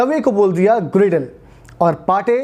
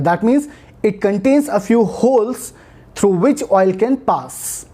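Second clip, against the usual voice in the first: slow explanation; much slower than usual. Shown as a demonstration. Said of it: "teaching explanation".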